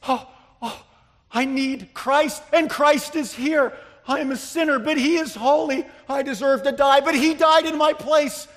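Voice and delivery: gasped "oh", rising into high, loud declaration; impassioned realization. Acted. Preacher acting out a convicted sinner finding Christ, fervent outcry. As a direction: cry it out as a sinner suddenly rescued.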